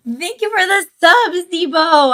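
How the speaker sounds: high, loud gushing thanks; excited thanks. Real, not acted. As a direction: squeal your gratitude. Thanking a new subscriber.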